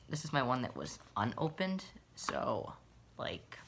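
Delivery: usual speaking voice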